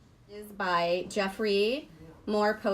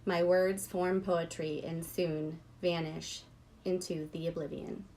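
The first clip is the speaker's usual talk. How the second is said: poetry reading; 3 semitones lower, 4 dB quieter than usual. Real, not acted. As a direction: linger over the line and let it fade softly. Rereads a favorite poem line.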